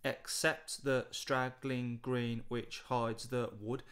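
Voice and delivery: slow, measured reading of the verse; slow poem reading. Shown as a demonstration. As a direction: read each line slowly, counting the beat. Reads poem lines slowly.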